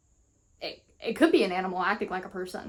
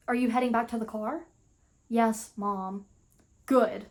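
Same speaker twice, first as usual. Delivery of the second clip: ordinary delivery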